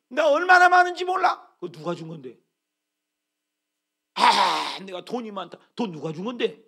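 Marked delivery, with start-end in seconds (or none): 0.1-1.5 much higher, louder gloating gasp; imitating a smug boaster; arrogant boast. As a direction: brag like a proud rich man. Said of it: "얼마나 교만합니까".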